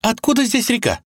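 high, startled question; surprised girl. Acted. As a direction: ask in amazement. Vasilisa voiced in story.

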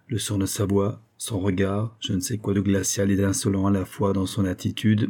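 ordinary delivery

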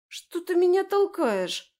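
Лера возмущается высоко и громко, медленно; обиженный возмущённый вскрик; возмущённо. A narrator's reading. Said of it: "возмутилась она". (Лера возмущённо отвечает подруге)

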